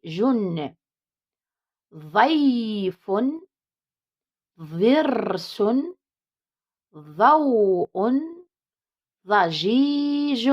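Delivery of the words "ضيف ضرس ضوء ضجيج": each word drawn out slowly for the class; teacher models the ض words slowly and loudly; slow model reading. Shown as a demonstration. Teacher reading ض words aloud to pupils, slow and clear. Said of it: "هادو هما كلمات لحرف الضاد".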